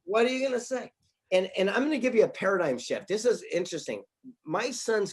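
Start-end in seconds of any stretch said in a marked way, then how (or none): 0.0-0.9 high, laughing retold aside; raised pitch, tickled and incredulous; amused helpless reaction. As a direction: give up the argument with a laugh. Amused reaction to son's comeback.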